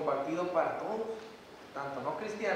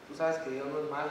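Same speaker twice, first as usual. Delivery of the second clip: normal talking voice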